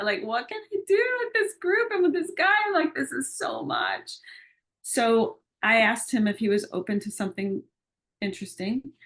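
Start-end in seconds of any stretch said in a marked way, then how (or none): 0.2-4.1 very high-pitched, strained plea for help; desperate retold prayer, pitched way up; overwhelmed plea.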